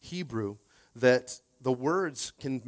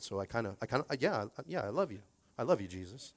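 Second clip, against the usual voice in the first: soft, stumbling declaration of love; acted sheepish, quiet hesitation; hesitant Peter; 3 semitones lower, 8 dB quieter, faster than usual. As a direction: hedge and trail into a half-hearted assurance. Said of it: "And Peter's saying".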